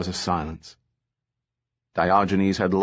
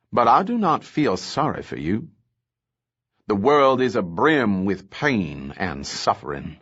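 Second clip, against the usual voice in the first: ordinary delivery, nothing marked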